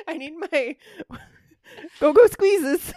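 high, loud, laughing punchline. Retold or imitated. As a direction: squeal it while laughing. Laughing mock intern line.

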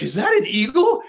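surprised. Retold or imitated. Cyclist suddenly spots a rare bird, astonished. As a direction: relive the thrill of spotting it, voice jumping up. Retelling surprise at spotting eagle.

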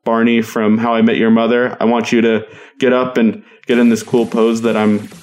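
ordinary talk

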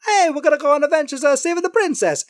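high, fast cartoon brothers; Mario and Luigi. Plumber brothers off to save the princess, high cartoon voice. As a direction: chirp it quickly in a high cartoon voice. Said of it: "Mario and Luigi are like".

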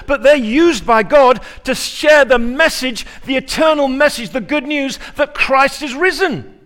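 loud, high, rising sermon climax; preaching at full force. Acted. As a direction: drive the point home to the congregation, full voice.

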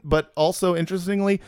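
usual speaking voice

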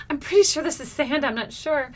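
high, emphatic puzzlement; retold bewilderment. Retelling puzzlement at finding sand.

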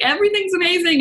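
high-pitched, gushing delight; mock elation. Speaker mimicking a good day in business, mock elated. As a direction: gush it in a high, singing-out voice.

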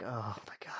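breathless unvoiced whisper. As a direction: whisper it in despair. GM voices Ramos hitting wall.

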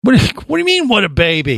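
high, offended protest; indignant. Real, not acted. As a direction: push back like you were insulted.

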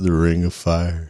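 lightly voiced low, slow country singer; a hint of a slow, low country legend; [name]. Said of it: "I just, just one time, Alan".

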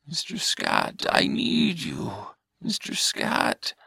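high then sinking, slow pleading alien; lightly voiced shaken alien pleading. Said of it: "starting to shake".

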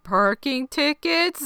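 high, drawn-out mock question; puzzled question. Recapper voices Randy guessing why agents came, puzzled.

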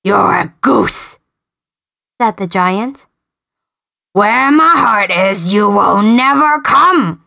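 slow, smug storybook giant. Acted. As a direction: boast like a giant. Giant boasts.

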